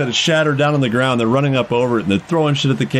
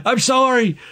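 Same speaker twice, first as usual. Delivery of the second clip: high, pleading put-on voice; apologetic character; 10 semitones higher, slower than usual. [name] apologizes to his teammates, high and pleading. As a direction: whine the apology, pitched well up.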